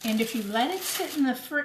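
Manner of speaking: usual speaking voice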